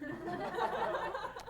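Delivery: short high burst of laughter. Real, not acted. Laugh inside mock quote.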